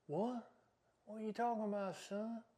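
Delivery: very soft, baffled mutter; quietly incredulous; hushed disbelief. Acted. Baffled reaction to the question.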